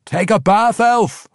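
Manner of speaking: high, loud gleeful dwarf howl; dwarf howling a taunt at the elf. Acted. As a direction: crow it at the falling elf. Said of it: "Brunner howled".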